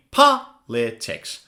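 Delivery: "Politics" slowly split, POL stressed; low, slow "Politics" with pauses between syllables. Shown as a demonstration. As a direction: model "Politics" in three separate beats for repeating. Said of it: "Now say it faster".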